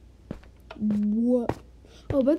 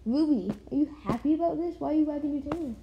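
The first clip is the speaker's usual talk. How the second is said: normal talking voice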